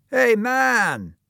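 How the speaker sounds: high, loud hail; calling out. Acted. Schoolboy hailing someone in the throng, loud call. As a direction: shout it across a crowd. Schoolmate hails Tom in a crowd.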